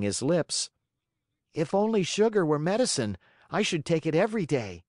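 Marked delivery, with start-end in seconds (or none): 1.5-4.8 put-on high, cheeky little wooden boy; puppet boy voice. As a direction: voice a greedy young puppet, pitched high. Pinocchio voiced by narrator.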